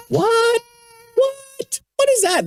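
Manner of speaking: very high, playful mock shock; mock surprise. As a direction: pretend to be amazed at the fussing. Reacting to toddler's fake cry.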